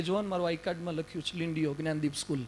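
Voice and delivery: timid lamb voice. Acted.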